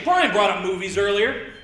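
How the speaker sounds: usual speaking voice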